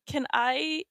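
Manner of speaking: normal talking voice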